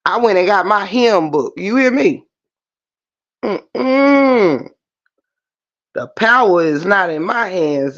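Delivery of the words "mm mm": high emotional mm-mm with a catch; a choked-up, heartfelt hum; touched, near-tearful mm-mm; moved hum. Real, not acted. Moved while talking about faith.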